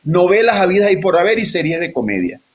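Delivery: ordinary talk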